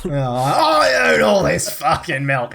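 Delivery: hammed-up, drawn-out boast; slow, swaggering put-on tycoon voice. Rich businessman boasts at his milk plant, hammed-up and slow.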